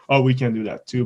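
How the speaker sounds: normal talking voice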